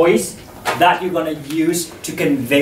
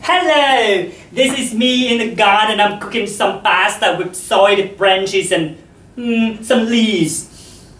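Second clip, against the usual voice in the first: put-on much higher, childlike voice; childhood voice; 6 semitones higher than usual. Shown as a demonstration. Coach performing his childhood self's voice, much higher. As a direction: play his childhood self cooking garden pasta. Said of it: "That is my authentic voice".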